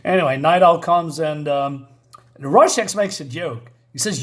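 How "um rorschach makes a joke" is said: usual speaking voice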